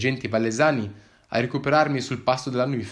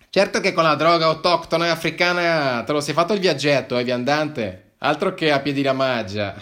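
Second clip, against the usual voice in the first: higher, louder taunting officer; mocking cop; 5 semitones higher, 6 dB louder than usual. Policeman mocks the traveller's long trip, taunting. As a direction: taunt the wanderer with scorn.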